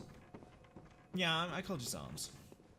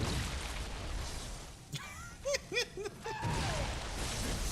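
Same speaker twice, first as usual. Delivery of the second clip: high wheezy giggle; long, breathless laughter; laughing. Real, not acted. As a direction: crack up at a dirty joke. Laughs at dirty joke.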